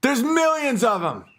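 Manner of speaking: high mocking yell; taunting shout. The boss taunting the bear, high mocking yell. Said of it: "It's like".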